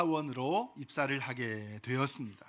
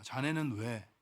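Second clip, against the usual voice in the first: lightly voiced older gentleman, soft and low; softly puzzled old gentleman, lightly acted; 3 semitones lower, 6 dB quieter than usual. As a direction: ask quietly, with a hint of an elderly man. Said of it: "이 노신사가 물었습니다".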